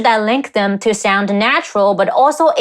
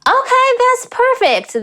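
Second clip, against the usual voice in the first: delighted approval; 13 semitones higher than usual. Real, not acted. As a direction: let the approval soar in pitch. Delighted verdict on a clip.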